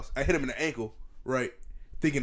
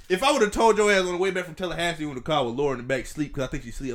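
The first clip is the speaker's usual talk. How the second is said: ordinary delivery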